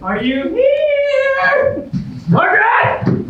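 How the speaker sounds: high, slow calling through the house. Acted. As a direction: call her name high and anxious. Calling for hidden ex.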